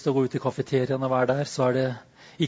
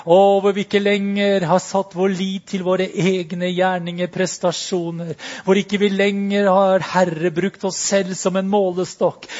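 higher and louder, preaching at full force; intense prayer; 5 semitones higher, 9 dB louder than usual. Real, not acted. Pastor crying out to God before the church, loud and passionate. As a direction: build to a passionate climax.

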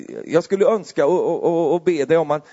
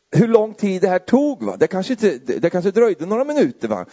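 ordinary talk

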